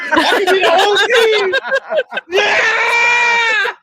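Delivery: high, loud, building to a shout; hyped bragging. Pretend. Joking he could be coach, player and team, high loud hype. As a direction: hype yourself up as the whole team. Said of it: "I was gonna be like".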